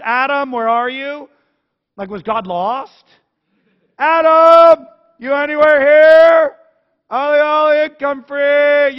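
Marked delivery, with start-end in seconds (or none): 0.0-1.3 slow, louder call for Adam; God calling out. Acted. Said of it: "And God comes and says". 4.0-9.0 much higher, much louder playful shout; sing-song hide-and-seek call. Acted. God calling like hide and seek.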